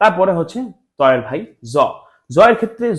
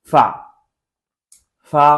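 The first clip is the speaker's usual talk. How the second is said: ordinary talk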